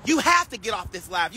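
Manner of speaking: usual speaking voice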